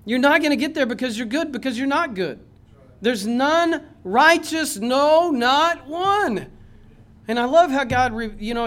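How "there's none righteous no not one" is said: "none", "no" and "not one" each loud, high and drawn out; emphatic scripture quote. Acted. Preacher quoting God on the righteous, emphatic on "no, not one". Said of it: "he emphasizes those things".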